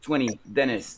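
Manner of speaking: "Twenty, dentist" as "twenny, dennist"; American dropped T. Shown as a demonstration. Teacher showing words where American English loses T, "Twenty, dentist". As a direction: say "Twenty, dentist" with no T after each N. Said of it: "on va faire disparaître le T".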